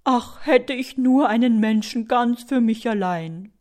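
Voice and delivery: hammed-up yearning louse; sighing longing. A narrator's reading. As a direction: wail the wish with a tear. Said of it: "seufzt die Laus und lässt eine zweite Träne kullern".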